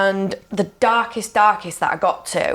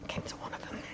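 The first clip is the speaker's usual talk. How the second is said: a hushed, voiceless aside; whisper; 22 dB quieter, faster than usual. Real, not acted. Guest admits she went to the events, whispering. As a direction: whisper it so no one hears.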